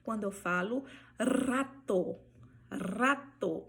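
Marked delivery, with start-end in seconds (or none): 1.2-2.2 RATO with a strongly trilled R; rolled initial R. Shown as a demonstration. Teacher showing R at word start, long trill on rato. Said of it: "ela vai vibrar". 2.7-3.6 RATO opening on a long tongue trill; rolled initial R. Shown as a demonstration. Teacher repeating the example, trilled R on rato. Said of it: "Essa palavra vibrou".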